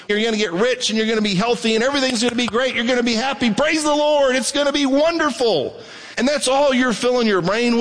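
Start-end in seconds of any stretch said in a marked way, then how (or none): 0.1-5.8 higher, fast mock-cheerful sales pitch; a sarcastic, hammed-up promise of easy blessings; mocking hype. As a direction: parody a prosperity preacher. Mocking prosperity teaching.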